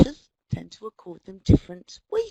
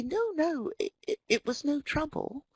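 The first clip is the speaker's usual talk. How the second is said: higher, lightly acted polite protest; British modest demurral; 6 semitones higher, 4 dB quieter than usual. Embarrassed Brit waving off an American's praise, mild polite protest. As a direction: brush off thanks in a higher voice. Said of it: "being British, I feel compelled to say".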